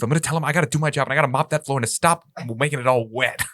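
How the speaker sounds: put-on low, grumbling old janitor; a tired janitor muttering his plans; gruff janitor voice. Plays lurking janitor.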